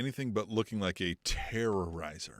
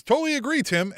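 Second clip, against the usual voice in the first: higher, louder bad Australian accent; hammed-up Aussie accent; 11 semitones higher, 6 dB louder than usual. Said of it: "my terrible Australian accent".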